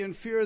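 emphatic fear in fear; stress on fear. Shown as a demonstration. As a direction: lean hard on fear in fear. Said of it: "there is emphatic position".

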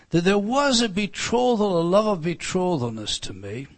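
loud fervent pulpit preaching; preaching at full force. Acted. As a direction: preach it hard to the congregation.

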